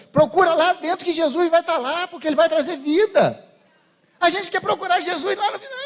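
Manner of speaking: loud, ringing pulpit preaching; fervent preaching. Acted. Preacher exhorting the congregation, full force.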